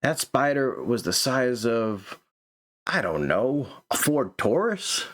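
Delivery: slow, spaced-out read with "Ford Taurus" rising at the end; character too high to finish the sentence, slow and wandering; stoned musing. Shown as a demonstration. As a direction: drift through it like a stoner who lost his thought. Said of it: "maybe because he's high and whatnot".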